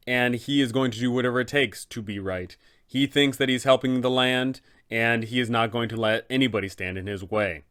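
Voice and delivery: usual speaking voice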